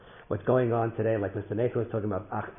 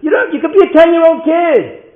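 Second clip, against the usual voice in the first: very high, loud crowning exhortation; impassioned shout; 18 dB louder than usual. Real, not acted. Speaker tells listeners anyone can do it, impassioned shout. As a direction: drive the lesson to its peak.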